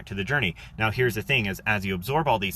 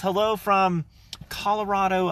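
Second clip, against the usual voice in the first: ordinary talk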